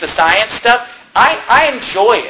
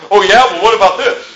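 mock retort. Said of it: "what comes out of their mouth".

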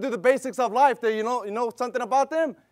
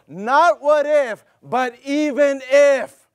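NOT and IF drawn out slow and loud; slow emphatic stress; 2 semitones higher, 6 dB louder, much slower than usual. Acted. Preacher to congregation, slow hammered stress on "Not what if, but even if".

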